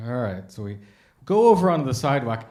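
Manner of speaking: normal talking voice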